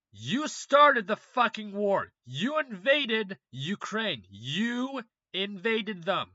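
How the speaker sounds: loud, slow, hammering each accusation; slow angry rant. Real, not acted. Scolding Putin for invading Ukraine: loud and slow. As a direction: spell out the charges one by one, heated.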